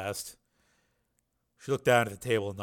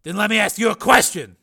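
louder, higher, pressing question; Steele, challenging; 11 semitones higher, 12 dB louder than usual. A narrator's reading. Patient challenges the scientist who rebuilt him, raised pressing voice. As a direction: push the question forward loudly.

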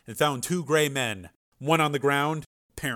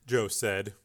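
usual speaking voice

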